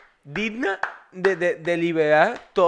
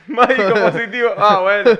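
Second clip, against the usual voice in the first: loud, higher burst through laughter; laughing exclamation; 5 semitones higher, 9 dB louder than usual. Real, not acted. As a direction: cry it out laughing. Laughing, much louder and higher.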